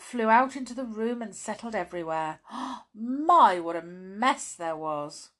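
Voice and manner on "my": surprised gasp. A narrator's reading. Gasp at the mess.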